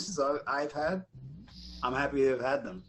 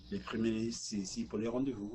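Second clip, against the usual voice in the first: low, quiet Quebec French; real Quebec French; 6 semitones lower, 13 dB quieter than usual. Retold or imitated. Auditioning actor giving the line, understated Quebec French. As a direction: say it softly in native Quebec French. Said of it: "For Quebec, I said".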